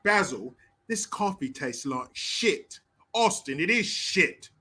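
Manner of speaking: slow, hammed-up British movie quote; deliberate British parody, leaning on "shit"; mock British accent.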